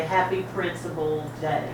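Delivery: ordinary delivery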